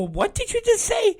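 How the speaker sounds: high-pitched parental double-take; startled parent. Retold or imitated. Dad asks his kid about a rude word, startled high voice. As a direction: question the kid in a suddenly high voice.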